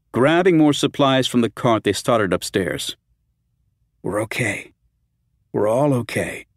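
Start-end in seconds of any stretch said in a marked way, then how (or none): 4.0-6.5 comforting. A narrator's reading. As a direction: comfort her gently. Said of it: "trying to comfort her".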